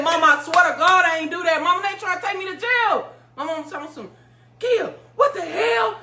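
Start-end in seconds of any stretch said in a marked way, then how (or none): 0.0-3.1 retold yell. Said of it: "I said, and I yelled". 4.6-6.0 high, drawn-out imitation of mama; outraged mother. Retold mother going off on phone.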